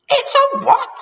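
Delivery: very high, loud frightened squeal; shrieking disbelief. A narrator's reading. Wife terrified in bed, high shriek. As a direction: shriek the question. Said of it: "screamed Missus Twit".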